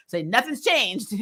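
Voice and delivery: ordinary delivery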